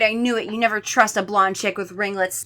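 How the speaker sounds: usual speaking voice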